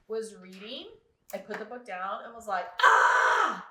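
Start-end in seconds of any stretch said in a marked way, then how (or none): 2.8-3.7 sudden loud yell of frustration; frustrated scream. Retold or imitated. Putting the book down to yell at the heroine, frustrated scream. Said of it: "I literally wanted to scream".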